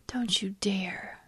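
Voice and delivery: gasp, then a slow whispered warning; mock-scandalized warning. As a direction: play at a hushed, slow threat. Mock-threat at deleting a post.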